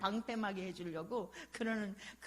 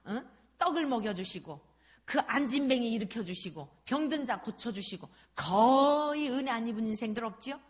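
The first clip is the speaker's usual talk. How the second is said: ordinary talk, nothing marked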